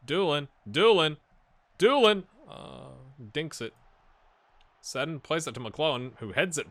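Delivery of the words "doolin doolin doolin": rising shout, much louder and higher; excited shout. Real, not acted. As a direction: cheer the player forward. Cheering on the attack.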